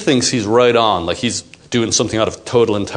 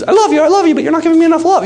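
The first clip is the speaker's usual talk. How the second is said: high put-on woman pleading; mock woman's voice; 3 dB louder, much faster than usual. Imitating a woman's hidden plea to her man, high and mock. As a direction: pitch way up and plead it, hammed. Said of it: "which means".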